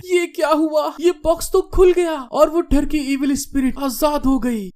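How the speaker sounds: high-pitched anxious inner thought; very alarmed. A narrator's reading. Girl finding the box has opened, panicked. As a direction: think aloud high, in dismay. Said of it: "बहुत ज्यादा घबराते हुए मन में".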